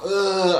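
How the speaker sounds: high, loud mock groan of an overheated man; a hammed-up heat-stroke groan; imitating a groan. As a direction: groan like you're melting in the heat. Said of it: "and he was like".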